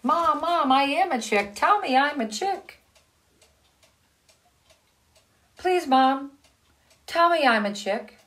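high, loud begging little voice; pleading duckling. Acted. Duckling begging the hen mother, high and pleading.

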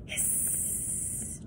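long breathy hiss with no voice; low spooky hiss. A narrator's reading. Chet hissing from the reeds to scare his friends, creepy hiss. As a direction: hiss like a lurking creature. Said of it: "Chet gave a low hiss".

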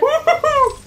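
high gleeful whooping cheer; excited whoop. Real, not acted. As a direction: whoop with joy. Celebrating ultimate in game.

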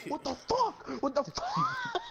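high, squealed imitation of fury; mock outrage. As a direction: end on a drawn-out, laughing squeal. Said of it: "looked like he was about to punch".

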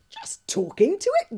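high, loud, disbelieving echo of the words; astonished gasp. Acted. Fishlegs gasps at Hiccup, loud, high and astonished. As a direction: Fishlegs can't believe it, voice jumping up high. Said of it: "gasped Fishlegs in astonishment".

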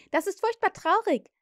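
put-on high, loud, cheery child; happily relishing the sad tale; cheerful. A narrator's reading. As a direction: say it with incongruous glee. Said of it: "sagte der erste Zwilling fröhlich".

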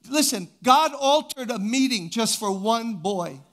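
rally the room to shout amen; fiery preaching. Real, not acted. Preacher rousing congregation.

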